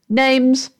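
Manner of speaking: abrupt. Acted. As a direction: demand it in one short word. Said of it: "asked the voice abruptly".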